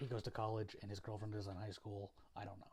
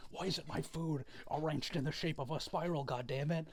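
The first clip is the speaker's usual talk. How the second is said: mock angry dad outburst, loud and higher; crashing out; 4 semitones higher, 4 dB louder than usual. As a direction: act out a dad losing it over dinner. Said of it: "crashing out at a fucking dinner".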